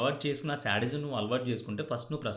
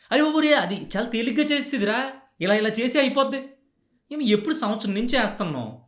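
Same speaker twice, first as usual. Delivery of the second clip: shouting, much higher and louder; furious scolding; 11 semitones higher, 8 dB louder than usual. Acted. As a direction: berate them at full volume. Acted scolding of someone careless.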